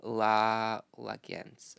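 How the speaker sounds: sounding out "La, Lagence" haltingly; a long "La", then "Lagence"; tentative pronunciation attempt. Shown as a demonstration. Vlogger trying an unknown brand name, tentative sounding-out. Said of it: "I don't know how to pronounce the name".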